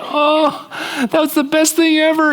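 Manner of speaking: high mock gush; gift lover gushing. Gift-lover thanking whoever bought the gift, in a high mock gush. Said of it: "you're like".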